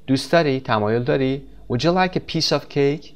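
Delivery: normal talking voice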